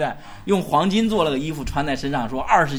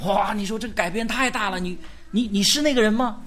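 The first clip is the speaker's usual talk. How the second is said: higher, wide-eyed disbelief; stunned passerby meeting a former beggar; amazed; 4 semitones higher than usual. Pretend. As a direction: gasp out the recognition.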